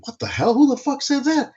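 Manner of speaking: jumps way up high in disbelief; stunned high-pitched what-the-hell; shocked outburst. Retold or imitated. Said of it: "And I'm like".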